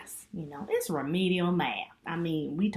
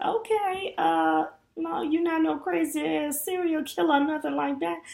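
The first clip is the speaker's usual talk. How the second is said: playful suspicion; 13 semitones higher, 8 dB louder than usual. Retold or imitated. Teasing the stranger about killers.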